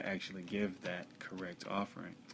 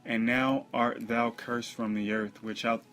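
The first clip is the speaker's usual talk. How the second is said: loud, higher, slow declamation; scripture proclamation; 3 semitones higher, 9 dB louder, slower than usual. Acted. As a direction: proclaim the verse forcefully and slowly. Reads scripture aloud.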